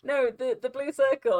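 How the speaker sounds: high, loud re-enactment of giving directions; flustered retelling. Retold or imitated. Retells flustered attempt to give directions.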